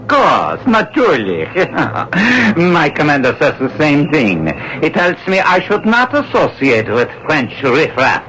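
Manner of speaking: a courteous Nazi officer probing with menace; Gestapo officer. Acted.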